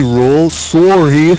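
drawn-out, mock-apologetic sorry; imitating Rock's sorry. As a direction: say sorry slow like a dubbed game character. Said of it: "The way Rock says sorry".

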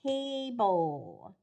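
"table" very slowly, TAY-bull; "table" drawn out with a long falling end; dictated word. Shown as a demonstration. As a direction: stretch both syllables of "table". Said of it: "Let's try table".

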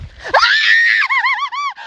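sudden high shriek at a snake; real scream of fright; jolted high scream; startled shriek. Real, not acted.

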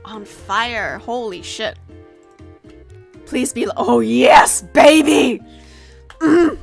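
ordinary delivery

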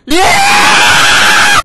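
long high shout; triumphant yell; victory shout. Real, not acted. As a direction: roar it out. Celebrating win.

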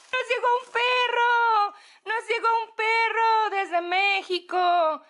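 loud, high joyful announcement; excited shout. Real, not acted. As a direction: shout the news happily. Excited about dog package.

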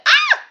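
very high, loud shriek; startled scream. Real, not acted. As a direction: scream at a sudden jump scare. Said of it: "that's a jump scare and a half".

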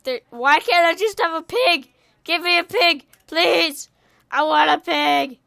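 loud, high begging; whiny pleading. Pretend. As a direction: beg the game like a thwarted kid. Begs game for a pig.

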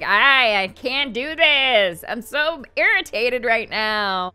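slow, drawn-out mock irritation; really mad. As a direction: act out a character fuming, loud first word. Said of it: "like, really mad".

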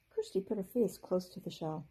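usual speaking voice

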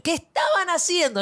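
high-pitched mock yell; worked-up, mildly shouted demand; retold scolding shout. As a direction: act out a mother yelling at the kids. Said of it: "yo gritaba".

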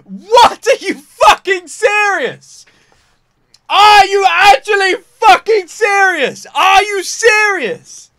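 high, loud, slow screams of disbelief; outraged screaming. Real, not acted. Reacting to shocking cliffhanger, screaming.

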